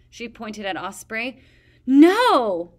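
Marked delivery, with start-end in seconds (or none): 1.9-2.7 loud high shout. A narrator's reading. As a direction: cry out in protest. Peril bursts out protesting.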